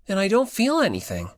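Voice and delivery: plain reading voice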